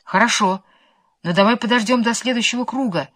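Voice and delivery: usual speaking voice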